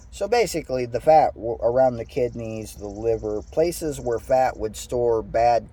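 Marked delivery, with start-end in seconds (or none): none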